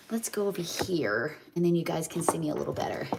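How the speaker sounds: hushed aside while moving the phone; quick whispered aside. Real, not acted. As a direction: drop to a breathy whisper. Moving the phone away from sink.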